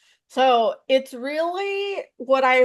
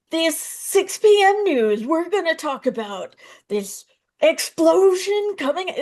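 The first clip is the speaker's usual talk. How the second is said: newscaster impression; 6 semitones higher than usual. Comedian mocking how newscasters talk, in a hammed-up anchor voice. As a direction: ham up a stiff TV news read. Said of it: "Like, newscasters".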